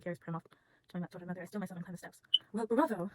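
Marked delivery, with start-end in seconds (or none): 2.5-3.1 gushing. Acted. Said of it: "gushes Effie Trinket".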